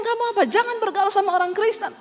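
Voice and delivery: a father's sharp reprimand; angry father. Retold or imitated. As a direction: scold like a furious father.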